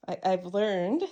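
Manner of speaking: usual speaking voice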